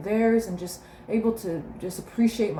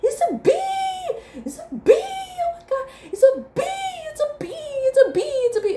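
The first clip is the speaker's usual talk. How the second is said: put-on very high, loud childish screams; high laughing kid shrieks; screaming kids; 5 dB louder than usual. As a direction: squeal like toddlers spotting a bee at night. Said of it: "they're screaming".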